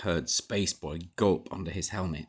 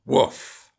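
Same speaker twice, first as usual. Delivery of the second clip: breathy louder bark; approving woof; 6 semitones higher, 6 dB louder than usual. A narrator's reading. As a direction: give a happy bark. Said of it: "barked his approval".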